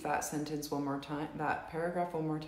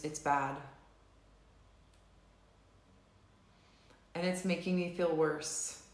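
slow, measured setup phrases with long pauses; tapping script read. Acted. Tapping leader reads the setup statements to the group, slow and measured. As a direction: guide the group through each tapping statement.

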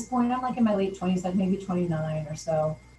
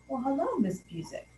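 lightly voiced lecherous judge, greeting her; sleazy greeting; 6 semitones higher than usual. Judge halting court to greet her, with a lightly sleazy tone.

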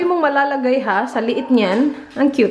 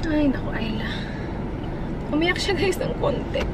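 teary whisper; 5 semitones higher, 5 dB quieter than usual. Real, not acted. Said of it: "Bakit ako naiiyak?".

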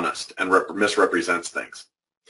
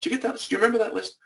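high, soft, quick sweet questions; a sugary reminder to a colleague who never made the list; mock-innocent question; 11 semitones higher, 7 dB quieter, much faster than usual. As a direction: ask with fake innocence to needle her.

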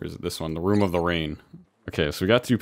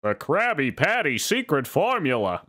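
higher, slow scheming voice; cartoon villain; 7 semitones higher than usual. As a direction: hiss out the plan like Plankton. SpongeBob villain impression.

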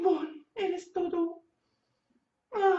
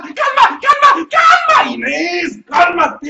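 shouting calm; 11 dB louder, slower than usual. Acted. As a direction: yell at her to stay calm, ironically losing it. Yelling at her to calm down.

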